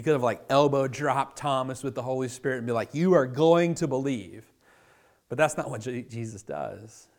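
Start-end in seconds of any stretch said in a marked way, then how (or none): 2.9-4.5 forceful declaration. Pretend. Imagined forceful Jesus crashing in.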